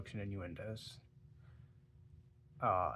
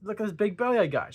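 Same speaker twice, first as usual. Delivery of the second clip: much higher and louder, hammed-up innocence; mock-indignant excuse; 9 semitones higher, 6 dB louder than usual. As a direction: sell the ridiculous lie with gusto. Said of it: "She's like".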